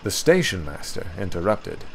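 plain reading voice

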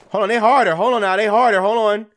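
urgent, loud and high; alarmed exclaiming. Real, not acted. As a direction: call out in rising alarm mid-fight. Alarmed by tougher enemies.

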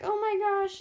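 lightly acted amazement; retold surprise. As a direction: re-enact a happy surprise. Retelling her birthday surprise.

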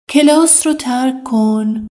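normal talking voice

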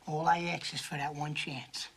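unvoiced, threatening whisper; a soft whispered menace; menacing whisper. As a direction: breathe the threat with no voice.

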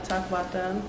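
ordinary delivery

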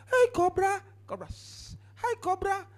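high, cautious call to a snake; wary cobra greeting. Pretend. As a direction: coax the snake nervously.

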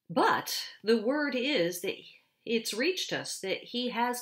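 awed, excited storyteller beaver; excited Mister Beaver. A narrator's reading. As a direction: beaver bursting to tell the news. Mister Beaver telling of Aslan.

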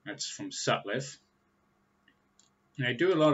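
talking around pipe stem; pipe in mouth. Real, not acted. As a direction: lips clamped on pipe. Seen 2: Pipe stem held at lips while talking, lowered after 3.1s.

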